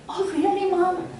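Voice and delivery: high, put-on teen voice, mock disbelief; sarcastic daughter. Daughter brushing off her mom with mock surprise.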